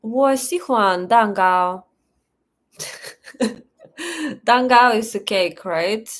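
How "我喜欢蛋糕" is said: slow, careful Mandarin sentence; 我喜欢蛋糕 said slowly in Mandarin tones; speaking Mandarin. Shown as a demonstration. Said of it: "Let's hear your Chinese accent".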